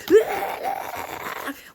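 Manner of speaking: loud high stuttering babble cry; hammed-up frantic outburst; mock frantic noise. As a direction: imitate someone flailing in chaos, loud and high. Mimes being frantic, not calm.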